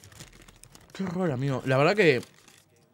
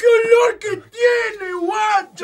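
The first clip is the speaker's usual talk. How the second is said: high, drawn-out shout of revulsion; disgusted wail; 13 semitones higher, 7 dB louder, much slower than usual. Real, not acted. Player recoiling from a stinking sweet, disgusted wail. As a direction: wail loudly at a horrible stench.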